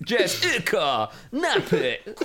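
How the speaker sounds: slow, drawn-out radio announcer. Acted. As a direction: announce her name like a pirate radio station DJ. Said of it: "Sounds like a pirate radio station".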